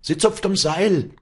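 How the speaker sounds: very high, loud shout. Acted. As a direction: yell the news down the chain. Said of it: "schrie der Vorderste". (lauter Schrei)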